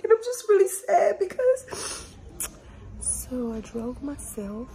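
real tearful voice, high and breaking, slow; words pushed out through real tears; crying. Real, not acted. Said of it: "of course I've been crying".

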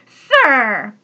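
a loud, sharp opening word; said loudly. A narrator's reading. Guard Bark confronting the logger, loud sharp address. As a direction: bark it out to grab attention. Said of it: "he said loudly".

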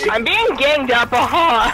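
slow, loud wail; drawn-out cry. Real, not acted. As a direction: cry out slowly for help. Player overwhelmed in game.

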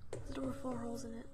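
soft whisper. Real, not acted. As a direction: hush it so nothing hears. Whispering in tense moment.

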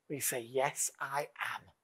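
soft whispered affirmation; hushed, intimate yes. Acted. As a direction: breathe the answer almost silently. Quiet affirmation after loud prompts.

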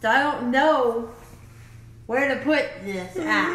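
slurred with a numb tongue. Real, not acted. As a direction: talk around a numbed tongue. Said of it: "I can't feel my tongue".

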